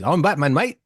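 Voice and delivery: put-on English accent slipping into Australian; mock English accent. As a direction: do a rough English-accented superhero. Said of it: "he has, he's English".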